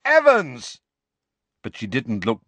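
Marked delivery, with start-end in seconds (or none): 0.0-0.8 high, loud, slow call; shouting after. Acted. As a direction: call after her as she leaves. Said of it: "James shouted after her".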